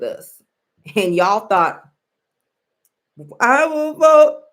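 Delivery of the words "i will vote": loud, high mock declaration; mocking quote. Speaker mimicking voters who thought they were special, mocking. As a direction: mimic their self-assurance, louder and higher. Mocks what y'all thought.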